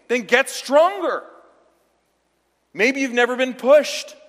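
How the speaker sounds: loud, high, no-nonsense coach; tough-love retort. Retold or imitated. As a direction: bark it back at the kid. Pastor retells tough-love answer.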